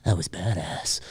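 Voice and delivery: low, soft smug quip; cool quippy Freddy. Retold or imitated. Quoting a cool Freddy quip, low smug purr. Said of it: "cool kind of quippy stuff".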